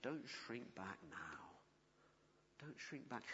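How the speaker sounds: almost under the breath, pleading; soft low plea to stay the course; soft earnest plea. Acted. Tender urging at close.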